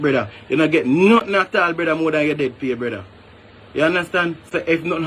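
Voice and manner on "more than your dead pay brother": usual speaking voice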